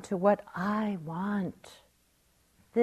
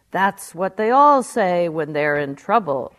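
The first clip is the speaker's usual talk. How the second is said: a louder, amused voice from above; booming voice of God; 10 dB louder than usual. Acted. God answers the dangling atheist with amused booming. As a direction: boom it across the canyon with a laugh in it. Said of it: "a mighty voice boomed across the canyon".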